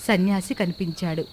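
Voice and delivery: usual speaking voice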